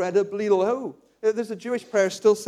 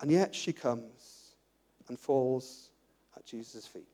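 very slow and much softer, fading; slow hushed telling; 9 semitones lower, 9 dB quieter, slower than usual. Acted. Preacher telling the woman falling at Jesus' feet, slow and hushed. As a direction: hush the room for the turn. Dramatic turn in the story.

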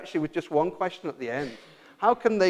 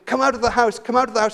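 raised and higher, pleading to come out; mock desperate scream; 7 semitones higher, 7 dB louder, faster than usual. Couple outside the shaking house calling people out, mock scream. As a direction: call urgently to the people inside. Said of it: "screaming and screaming".

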